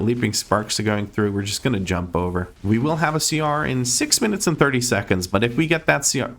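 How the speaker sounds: usual speaking voice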